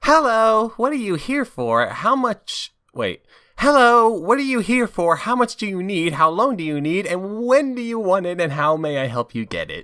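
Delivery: very high, chirpy receptionist. Acted. Receptionist greeting Sonic at the spa, high and chirpy. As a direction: squeak it out like a bubbly receptionist. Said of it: "I'll play the receptionist".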